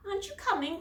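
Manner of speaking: calling. A narrator's reading. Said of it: "called Susie".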